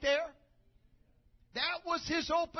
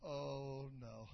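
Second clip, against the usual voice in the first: dismayed whisper; 11 semitones lower, 12 dB quieter than usual. Retold or imitated. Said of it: "He said".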